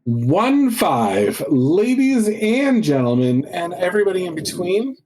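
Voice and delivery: slow, drawn-out announcer build-up; show announcer. Acted. Co-host introducing the podcast episode to listeners, show announcer. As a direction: stretch it out high like a ring announcer.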